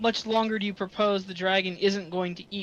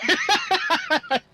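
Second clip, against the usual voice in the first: laughing; 7 semitones higher, 4 dB louder, much slower than usual. Real, not acted. Laughing retelling.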